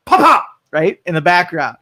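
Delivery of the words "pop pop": high, loud pop pop; mock gunshots. Engineer shot in the background, with mock gunshot pops. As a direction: shout out two gunshots. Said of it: "you hear".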